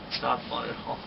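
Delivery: ordinary talk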